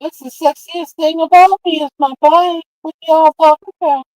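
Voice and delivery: high, loud character voice; put-on high voice. Bragging about the bike to y'all, high loud put-on voice. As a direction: pitch it way up and loud, mock indignant.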